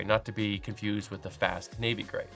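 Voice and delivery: ordinary talk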